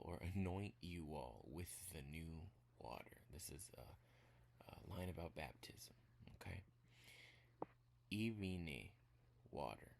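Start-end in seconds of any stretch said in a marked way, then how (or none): 3.3-6.6 whispered aside. Real, not acted. Aside during language lesson.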